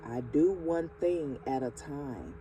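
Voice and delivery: slow, calm affirmations, each phrase set apart; slow affirmation reading. Acted. Reading affirmations aloud to the viewer, slow and calm. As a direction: lay each affirmation down slowly and steadily.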